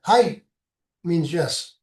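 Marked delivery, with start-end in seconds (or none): 0.0-0.4 "Hi" said short, high and loud; "Hi" demonstrated as a sharp Japanese yes; Japanese hai demo. Shown as a demonstration. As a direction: bark out "Hi" crisply as the Japanese yes. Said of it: "you say it like that".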